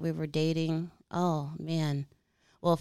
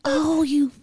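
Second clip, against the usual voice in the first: mock angry; 11 semitones higher, 9 dB louder, slower than usual. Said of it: "I don't even know you're angry right now".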